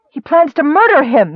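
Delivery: high, loud, urgent cry; alarmed cry. A narrator's reading. Nicole trying to free herself, crying out. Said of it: "Nicole cried".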